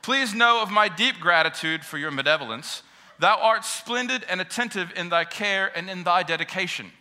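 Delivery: hammed-up, stately Old English address; mock-formal children. Girls greeting their father at the bus stop, mock-formal. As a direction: play daughters addressing Father with exaggerated ceremony. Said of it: "with loving looks in their fi-, their faces, they said".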